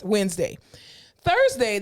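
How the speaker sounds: normal talking voice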